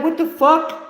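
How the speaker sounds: high, loud indignant outburst; mock outrage. Imitating the ladies left hanging, mock outrage. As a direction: blurt it out in high-pitched disbelief.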